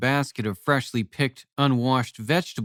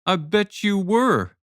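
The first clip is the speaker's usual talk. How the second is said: slow, higher, mocking drawl; sarcastic smirk; 8 semitones higher than usual. A narrator's reading. Father mocks his friend's late offer, slow sarcastic smirk. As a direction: needle him, draw it out with a smirk. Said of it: "Jonas smirked sarcastically".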